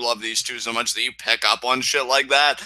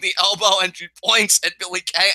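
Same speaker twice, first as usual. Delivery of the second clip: ordinary delivery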